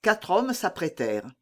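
ordinary delivery

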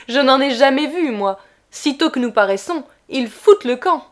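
loud, mocking drunken brag; tipsy glee. A narrator's reading. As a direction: boast gaily, flushed with wine. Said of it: "rendu très gai par le vin".

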